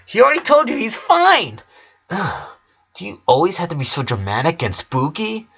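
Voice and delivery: put-on a young twin snapping at her sister; a high, whiny twin voice that fades into a groan; annoyed little girl. A narrator's reading. Twin snaps at gloomy sister.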